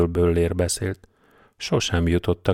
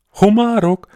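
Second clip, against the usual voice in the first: startled shriek; 14 semitones higher, 9 dB louder, slower than usual. A narrator's reading. Sergeant startled by lobsters.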